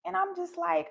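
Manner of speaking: usual speaking voice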